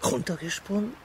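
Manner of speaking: incredulous echo. Acted. Echoes her words in disbelief.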